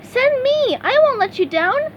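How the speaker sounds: very high, eager cartoon sponge; bright squeaky helper ready to go; eager SpongeBob voice. A narrator's reading. SpongeBob volunteering to his boss, high eager voice.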